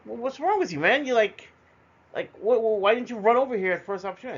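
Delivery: the brother scolding, baffled and urgent; exasperated brother. Retold or imitated. Brother scolding him for not running.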